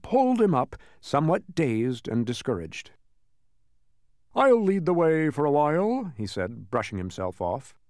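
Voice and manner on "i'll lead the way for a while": high-pitched character voice; self-important humbug. Acted. Humbug brushing himself off, high and self-important. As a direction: take charge in a raised bug voice.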